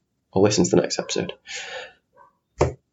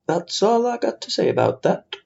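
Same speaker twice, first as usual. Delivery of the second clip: amateur movie impression, pitched up; Forrest Gump; 9 semitones higher than usual. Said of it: "That's also my Forrest Gump accent".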